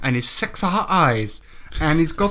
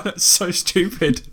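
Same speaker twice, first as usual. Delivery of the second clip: normal talking voice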